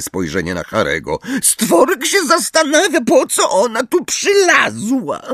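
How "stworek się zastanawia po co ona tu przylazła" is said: house-elf voice. A narrator's reading. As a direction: wonder aloud as a squeaky, resentful elf. Elf Stworek talks to himself. (bardzo wysoki głos skrzata)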